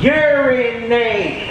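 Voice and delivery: slow, high sing-song chorus; drawn-out class chorus. Retold or imitated. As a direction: stretch it like a whole class answering.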